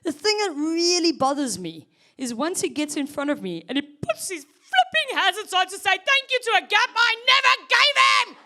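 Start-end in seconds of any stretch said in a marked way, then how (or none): 3.7-8.4 comic road-rage outburst; exasperated rant. Real, not acted. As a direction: vent the fury, climbing to a shout. Ranting about rude driver.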